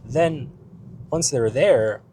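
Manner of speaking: ordinary delivery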